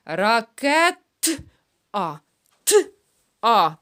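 "Ракет-а" slowed with the т split off; slow "Ракет-а" isolating the т; isolated t sound. Shown as a demonstration. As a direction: break "Т-а" apart loudly. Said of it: "Повторили".

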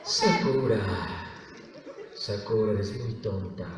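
low, soft, dark character voice; brooding, deep and quiet; Yue. Shown as a demonstration. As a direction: coldly belittle her in a deep murmur. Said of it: "yo es oscuro".

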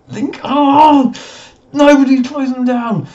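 high, loud yell at the team; frustrated shout. Real, not acted. Manager yelling at his defence for not closing down, frustrated shout. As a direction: bellow in exasperation at the players.

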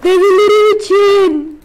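slow, loud, high war cry; battle roar. Real, not acted. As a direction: roar it for the grandpas. Rallying cry for the grandpas.

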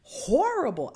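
high, drawn-out "horrible"; mock-appalled reaction, swooping up on "horrible"; imagined appalled listener. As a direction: imitate someone hearing something awful. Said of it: "I know it sounded like".

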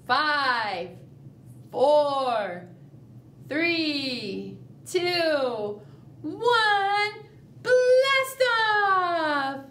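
rising countdown ending in a cheer; countdown to blast off. Shown as a demonstration. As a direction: count down with the class, then launch. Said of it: "Let's count down".

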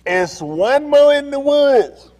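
slow, high, loud drawn-out call; long drawn-out shout. Retold or imitated. Retelling the call into the woods, long loud holler. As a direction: holler every word slowly.

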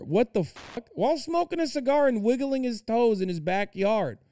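high, exasperated what the fuck; incredulous outrage. Real, not acted. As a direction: pitch it up in stunned disgust. Outraged at husband's video.

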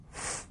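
f as a long voiceless puff, no vowel; just the friction of f, held; fricative f. Shown as a demonstration. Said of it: "上牙齿要咬着下嘴唇".

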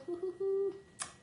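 high-pitched little laugh; high giggle. Real, not acted. Giggles after thanking a compliment.

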